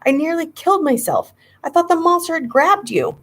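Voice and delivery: high, upset scolding; indignant outburst. A narrator's reading. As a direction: scold him for the scare. Furious at scared brother.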